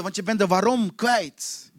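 normal talking voice

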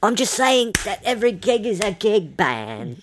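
high, slow rock star voice; [name]. Retold or imitated. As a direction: lay on the Jagger drawl. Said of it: "[name] says".

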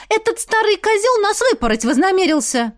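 high, indignant tattling; aggrieved girl. A narrator's reading. Дуняша telling Ратмир of the flogging, indignant. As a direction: tell on someone in a high, outraged voice.

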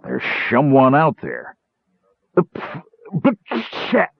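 slurred, slow stage warning; drunken whispering. A narrator's reading. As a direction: warn secretively, tripping drunkenly over the sounds. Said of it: "whispered Karaman close to Tass's ear".

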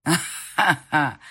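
slow, loud mocking laugh; mocking guffaw. Lover mocking her with slow loud laughter. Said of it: "ржал этот урод".

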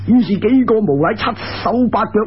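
plain reading voice

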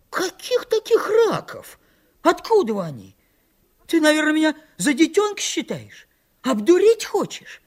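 put-on high, outraged boyish wail; stung yelling. A narrator's reading. Митька accuses his friend of tricking him, high indignant wail. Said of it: "завопил, как ужаленный Митька".

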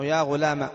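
"يا غلام" ending on a short a; alif dropped, fatha kept. Shown as a demonstration. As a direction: cut the last vowel of "يا غلام" short. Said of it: "حذف الألف والاجتزاء بالفتحة".

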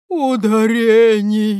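mild sob of grief; through tears. Acted. The weeping statue answers Грамотейка, tearful. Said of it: "вымолвила статуя сквозь слёзы".

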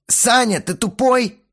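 high, loud exasperated insult; angry yelling. A narrator's reading. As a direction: yell it at your bandmate. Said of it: "заорал Бельфигор". (орёт в раздражении)

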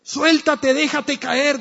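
high, loud pleading shout; father shouting. Acted. Father urges his son at the window to drop, loud and high. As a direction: call up hard, begging him to let go. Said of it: "gritaba el padre".

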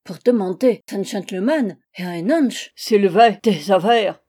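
put-on loud German-accented tirade; affronted accented angel. Angel scolding the ill-bred host, affronted creature. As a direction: berate him in mangled French.

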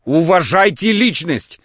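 громко и медленно, с нажимом; перебивает, выкрикивая по слогам; перебивающий крик. A narrator's reading. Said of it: "перебил его Лаевский". (медленный громкий выкрик)

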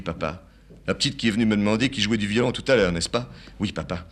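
normal talking voice

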